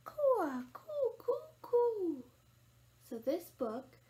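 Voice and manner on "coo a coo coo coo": high, drawn-out dove coo; imitating a cooing dove; dove call. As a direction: coo like a dove, falling at the end. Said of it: "you get to make all the bird calls".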